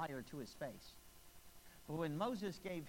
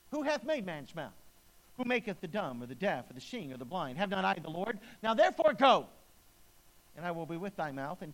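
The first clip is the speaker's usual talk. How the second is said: impatient God; 8 dB louder than usual. Acted. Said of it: "God's patience is running thin".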